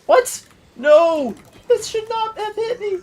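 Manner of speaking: high shocked yell, real disbelief; shocked outburst. Real, not acted. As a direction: cry out in sudden outrage. Hit unexpectedly in game fight.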